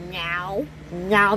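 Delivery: normal talking voice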